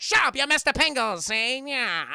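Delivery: very loud, high mobster bark; hammed-up angry gangster shout; bossy gangster yell. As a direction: shut him up like a cartoon crime boss. Boss silences the henchman.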